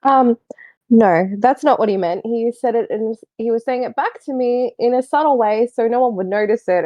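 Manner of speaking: usual speaking voice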